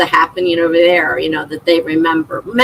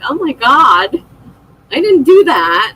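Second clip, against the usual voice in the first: higher, louder oh my God, protesting innocence; mock protest; 5 semitones higher, 3 dB louder than usual. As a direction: play it up like you've been framed. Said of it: "I'm like".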